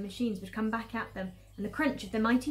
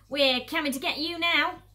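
loud, raised-pitch taunt; yelled threat; 5 semitones higher, 8 dB louder than usual. Acted. Bunce leaning from his tractor to Mister Fox, yelling. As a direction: yell it at the fleeing quarry. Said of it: "yelled Bunce".

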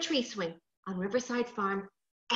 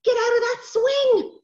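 loud, high, slow cross order; a bit angry; 12 semitones higher, 8 dB louder than usual. Acted. Sister losing patience with brother on swing, angry shout. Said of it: "getting a little bit angry now".

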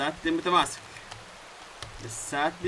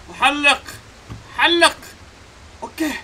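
much higher and louder; excited urging; 9 semitones higher, 6 dB louder, slower than usual. Real, not acted. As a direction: cheer the jump on. Urging character to fly.

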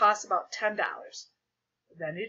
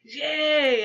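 loud, raised, drawn-out yay; shouted cheer; 6 semitones higher, 7 dB louder than usual. A narrator's reading. Classmates cheer the ice cream idea, shouted. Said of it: "everybody shouted".

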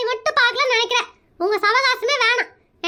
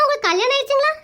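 ordinary delivery

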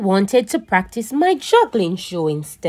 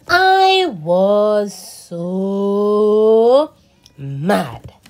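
mock sulky drawl; 5 dB louder, much slower than usual.

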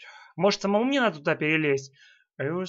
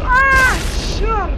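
frustrated cry; 7 dB louder than usual. Real, not acted. Failing the level.

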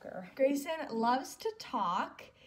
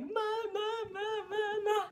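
much higher sing-song "Ma, ma" whine; imitating mad baby; 10 semitones higher, 4 dB louder, slower than usual. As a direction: whine like an upset baby, high. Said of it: "he's mad".

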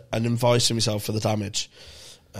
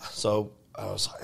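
ordinary talk, nothing marked

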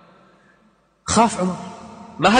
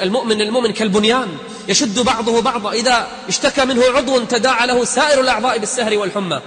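raised, higher impassioned preaching; fervent preaching; 6 semitones higher, 5 dB louder than usual. Acted. Preacher urging the congregation to care for each other, raised and fervent. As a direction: drive the hadith home.

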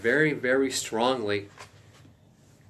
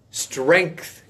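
normal talking voice

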